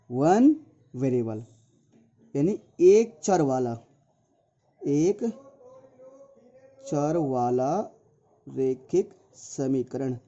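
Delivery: slow, with long gaps between words; spaced-out title, piece by piece. Real, not acted. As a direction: say it slowly as you write it out. Writing out the topic title aloud.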